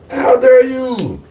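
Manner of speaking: hurt pride rising in the voice; indignant. Retold or imitated. Indignant reply to daughter.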